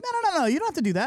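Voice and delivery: quick, high eager refusal; eager protest. Retold or imitated. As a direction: rush out a polite no. Couple refusing politely.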